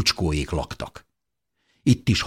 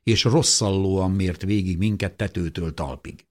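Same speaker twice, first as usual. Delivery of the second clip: usual speaking voice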